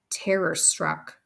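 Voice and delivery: ordinary delivery, nothing marked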